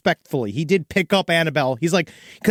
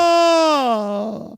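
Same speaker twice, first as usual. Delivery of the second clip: mock shriek; 6 semitones higher than usual.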